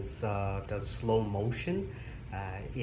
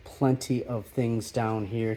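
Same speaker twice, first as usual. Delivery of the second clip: usual speaking voice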